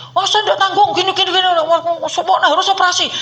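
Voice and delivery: loud, high scolding doctor. Retold or imitated. Doctor refusing the patient, loud and scolding. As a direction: bark at the patient like an annoyed doctor. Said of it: "aku dimarah-marah gitu".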